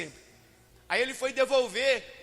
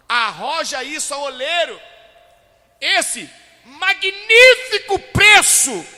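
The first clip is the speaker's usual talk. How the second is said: loud, high ironic proclamation swelling on "magnífico preço"; mock-grandiose, booming and drawn out at the end; 7 semitones higher, 15 dB louder than usual. Preacher voicing the Lord on the price paid, heavily ironic. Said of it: "Entendeu a ironia aí?".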